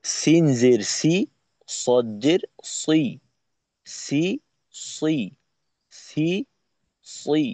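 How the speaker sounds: সি thin with teeth, সই thick and rounded. Shown as a demonstration. Teacher contrasting sin and sad, thin against thick. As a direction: alternate thin সি and heavy সই slowly. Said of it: "মোটা পাতলার মাধ্যমে".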